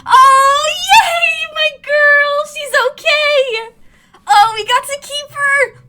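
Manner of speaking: very high, loud squealing cheer; overjoyed boy. Acted. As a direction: squeal with joy the pet is safe. Cal cheers, pet saved.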